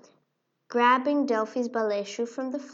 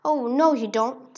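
defiant mouse; 7 dB louder than usual. Acted. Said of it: "said the mouse".

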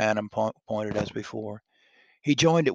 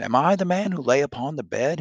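crying out; 5 semitones higher, 7 dB louder than usual. Acted. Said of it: "he cried".

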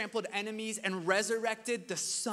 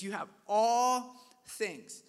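"You have ALL things" with ALL drawn out long; slow emphatic quote; 3 semitones higher, 5 dB louder, slower than usual. Acted. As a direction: lean hard on ALL, slowly. God's words stressed slowly.

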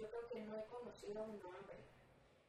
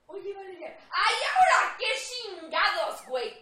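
much louder, higher annoyed snapping; impatient snap; 8 semitones higher, 20 dB louder, much slower than usual. Retold or imitated. Imitating her friend snapping at the suitor, annoyed. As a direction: snap at him, fed up. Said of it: "agotaba mi paciencia".

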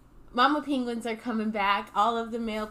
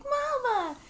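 very high chick cry of mama; little Mumble calling; 13 semitones higher than usual. Retold or imitated. Baby Mumble crying out for his mother. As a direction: wail for your mother like a chick. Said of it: "he's just kinda sitting there like".

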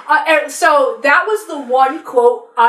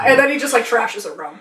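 ordinary talk, nothing marked

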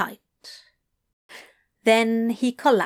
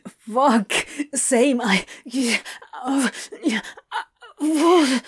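breathless cry; 3 semitones higher, slower than usual. Acted. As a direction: cry out, voice breaking. Climax, breaking.

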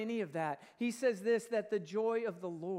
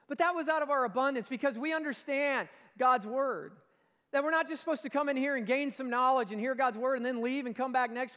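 louder, driving sermon voice; preaching at full force; 3 semitones higher, 6 dB louder than usual. Acted. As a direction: press the point home to the church.

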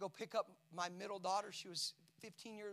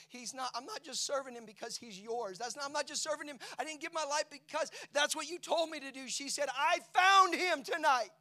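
rising passion; 7 semitones higher, 10 dB louder than usual. Retold or imitated. Daughter tells dad she found faith.

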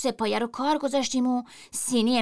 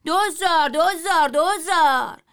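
higher, louder repeated price call; shouting price; 6 semitones higher, 4 dB louder, slower than usual. A narrator's reading. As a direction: bark the price three times. (فریاد بلند قیمت «دوزار»)

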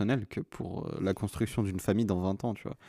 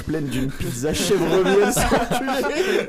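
laughing; 7 semitones higher, 6 dB louder, much slower than usual. Real, not acted. Teasing a friend eating pizza.

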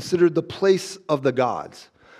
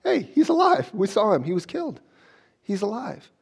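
high, hushed mock disbelief after a yelped start; mock freak-out, high-pitched; acted freaking out; 5 semitones higher than usual. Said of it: "they're kinda freaking out".

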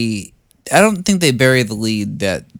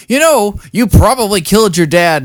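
high, loud mock cop voice; hammed-up accusing cop; imitating the cop; 9 semitones higher, 9 dB louder than usual. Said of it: "the cop that was like".

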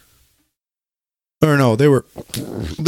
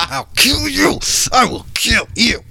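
mock threat; 13 semitones higher than usual. Mock threat after teasing.

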